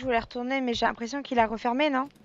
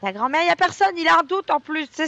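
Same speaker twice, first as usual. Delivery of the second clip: ordinary delivery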